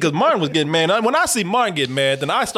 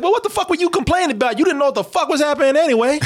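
mock retort; 8 semitones higher than usual. Imagined retort.

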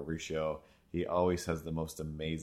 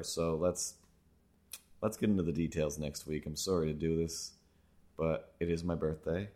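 normal talking voice

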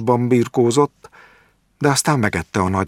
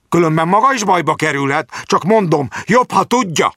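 high, louder menacing threat building to a peak; raised voice; 9 semitones higher, 3 dB louder than usual. A narrator's reading. Jáki threatening the doctor on his way out, voice raised. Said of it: "emelte fel a hangját".

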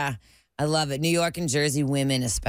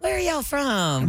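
higher, slow Southern waitress drawl; put-on Southern accent; 8 semitones higher, slower than usual. Shown as a demonstration. Waitress greeting tourists for tips, put-on Southern accent. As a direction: turn on the Southern charm. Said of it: "I would turn it on".

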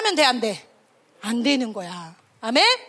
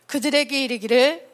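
usual speaking voice

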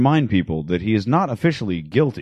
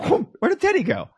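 high squeaky voice. Retold or imitated. Acted portal vanishing.

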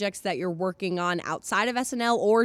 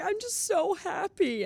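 much higher, slow, tearful gush; teary happy quote; 10 semitones higher, slower than usual. Retold or imitated. Host on randomly crying from happiness, slow teary gush. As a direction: choke out joy on the verge of tears. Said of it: "I'll be like".

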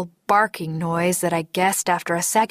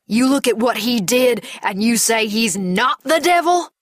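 raised, higher-pitched angry disbelief; outraged challenge; 4 semitones higher, 4 dB louder than usual. Acted. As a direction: build indignantly to an incredulous question. Enraged man towering over the narrator.

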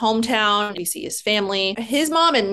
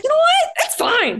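much higher and a bit louder, airy and dismissive; breezy carefree self-mockery; 3 dB louder than usual. Narrator mocking her younger self, breezy and carefree. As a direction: brush it all off like a naive girlfriend.

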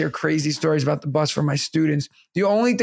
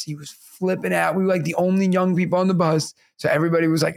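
usual speaking voice

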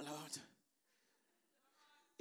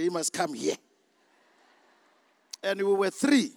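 louder, pressing the point home; fervent preaching; 7 dB louder than usual. Acted. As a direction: preach it hard to the crowd. Preaching to the congregation.

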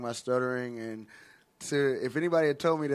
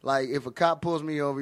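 ordinary talk, nothing marked

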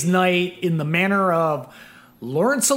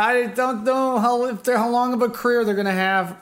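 higher-pitched mock reluctance; doubtful mimicry; 5 semitones higher than usual. Host imitating a producer doubting the band's future, doubtful. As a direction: play the unconvinced producer, pitched up. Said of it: "Just like".

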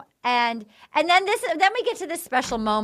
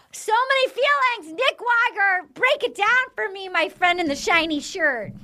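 high, mock-announcer handoff; playful handoff; 6 semitones higher than usual. Real, not acted. Host handing the floor to cohost in a high playful announcer tone. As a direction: toss it over to your friend with a flourish.

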